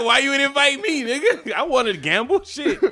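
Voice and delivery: laughing complaint. Pretend. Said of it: "I'm gonna be like".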